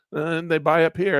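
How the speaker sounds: usual speaking voice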